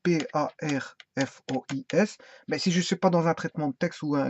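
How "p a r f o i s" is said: « P A R F O I S » épelé lentement, une lettre à la fois; épelle le mot, lettre après lettre; épelé lettre par lettre. Shown as a demonstration. (épeler lentement chaque lettre)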